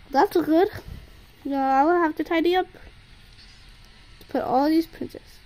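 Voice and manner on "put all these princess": ordinary talk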